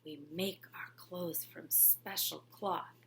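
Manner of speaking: unvoiced secret whisper; a trickster whispering to the principal. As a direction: breathe it into his ear. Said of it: "he whispered".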